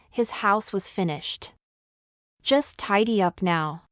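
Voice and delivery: usual speaking voice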